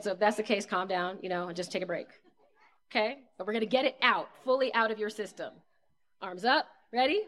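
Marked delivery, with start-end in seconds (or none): none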